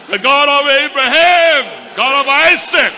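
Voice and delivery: loud, high preaching cry, invoking God; shouted prayer. Real, not acted. Preacher reenacts his prayer on the floor, shouting. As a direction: call on the God of Abraham at full volume.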